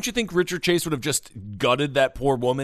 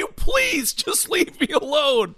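high-pitched comic pleading; exasperated witness; 10 semitones higher than usual. Acted. Witness begging the killer to go, high and exasperated. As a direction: protest high, dragging out the final plea.

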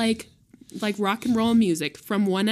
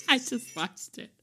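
laughing; 7 semitones higher than usual. Real, not acted. Laughing while watching video.

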